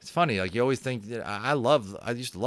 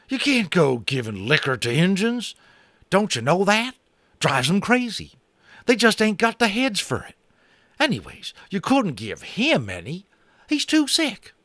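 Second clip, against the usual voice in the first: high, loud scandalized cowboy; shocked cowboy; 11 semitones higher, 4 dB louder than usual. Acted. Boone shocked, warns Omri off giving liquor, high cowboy. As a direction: lecture him in a shocked cowboy twang. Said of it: "Boone looked shocked".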